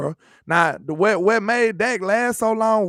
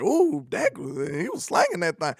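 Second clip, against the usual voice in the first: impressed fans; 2 semitones lower, 5 dB quieter than usual. Retold or imitated. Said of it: "they like".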